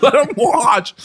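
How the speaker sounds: high mock-furious cry; Grimace, really mad. Storyteller replays Grimace's outburst, high mock-angry yell. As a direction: cry it out high, in hammed-up rage. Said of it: "Grimace gets really mad".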